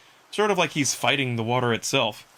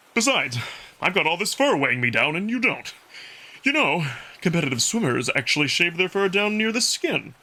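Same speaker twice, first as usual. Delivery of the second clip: Amicus the wolf, a touch higher and brighter-timbred, easy and friendly; 5 semitones higher than usual. Acted. Wolf Amicus catching his breath after the race, playful role voice.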